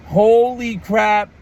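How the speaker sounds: slow, loud, stretched-out amazement; awestruck. Real, not acted. Fan reliving the reveal, loud amazed. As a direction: let the awe land word by word. Reacting to a huge crowd pop.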